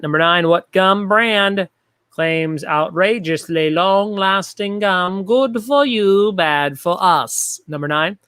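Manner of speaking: big, slow, drawn-out announcer delivery; exaggerated host voice. Shown as a demonstration. Trivia host reading the gum question to players, exaggerated host voice. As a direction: stretch out every word like an over-the-top host. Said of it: "talking like this".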